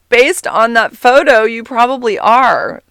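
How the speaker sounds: teasing. Retold or imitated. Zinger reply, still laughing.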